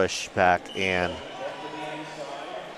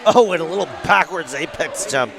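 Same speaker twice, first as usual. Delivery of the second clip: excited; 8 semitones higher, 4 dB louder than usual. Real, not acted. Commentator thrilled by a jump.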